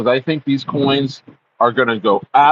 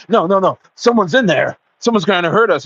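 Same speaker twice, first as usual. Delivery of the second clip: higher, urgent mock panic; hammed-up fear, pitched up; mock frightened girlfriend; 6 semitones higher than usual. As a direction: squeal it like a scared partner.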